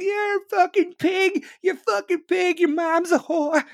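high, screechy taunting yell; loud mock-hysterical protester voice. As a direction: scream abuse like a caricature heckler. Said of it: "getting in the cops' fucking faces".

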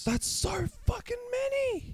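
squeaky disbelief. Real, not acted. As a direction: squeal it in shock. Reacting to the huge count.

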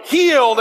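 healed hit high and loud; stress on healed. Shown as a demonstration. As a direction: punch the word healed for the crowd to echo. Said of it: "say the H word".

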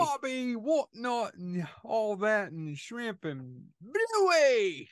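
high, slow, hammed-up Australian accent; mock Australian accent. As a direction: stretch out a silly fake Australian accent, especially the last word. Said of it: "for our Australian friends".

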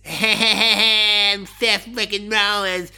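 slow, stretched mock wrestler boast; drawn-out mocking impression of a cocky wrestler; mocking Seth Rollins. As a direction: ham up a swaggering wrestler catchphrase. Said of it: "he's just this".

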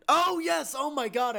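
light up as the memory hits; excited recognition. Real, not acted. Co-host suddenly remembers the film's first scene, excited.

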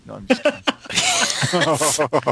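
slow, laughing through the words. Real, not acted. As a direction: giggle it out slowly. Laughing retraction.

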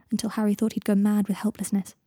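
usual speaking voice

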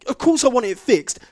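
loud, high, indignant tirade; angry rant. Retold or imitated. Customer ranting at the agent about his broken TV, angry. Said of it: "I was all angry".

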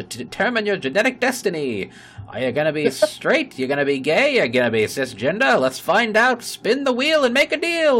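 high, loud barker's pitch; carnival barker. Retold or imitated. Spinning the wheel as a loud carnival barker. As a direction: drum up the crowd at the wheel. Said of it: "you sounded like a carnival barker".

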